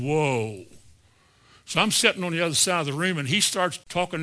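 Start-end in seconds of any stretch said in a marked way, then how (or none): 0.0-0.7 long, low whoa; retold amazement. Preacher recalling the deep voice from the woman, retold amazement. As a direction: draw it out low, imitating amazement. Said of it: "I'm going".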